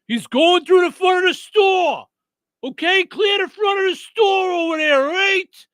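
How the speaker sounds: high, loud, urgent police shout; mock frantic officer shouting; urgent cop impression. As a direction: yell it like a panicked cop clearing the store. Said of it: "something about that accent with the urgency in his voice".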